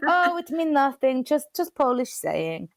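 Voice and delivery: put-on a lightly done Polish accent, brushing it off; bad Polish accent. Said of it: "my really bad Polish accent".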